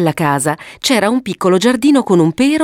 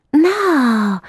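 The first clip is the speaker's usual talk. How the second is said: put-on higher childlike voice, knowing; wise little girl; 8 semitones higher than usual. Violetta setting her brother straight, sagely. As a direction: correct him like a sensible big sister. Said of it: "diceva saggiamente Violetta".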